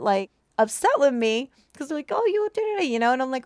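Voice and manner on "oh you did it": high, mock-accusing child voice; accusing kids. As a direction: play the kids blaming her, way up high.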